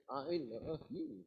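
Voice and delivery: slow "I love you" lifting on "you"; awkwardly over-emphatic "I love you"; misplaced chopping emphasis. Shown as a demonstration. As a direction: stretch out each word of "I love you", ending upward. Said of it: "It's weird".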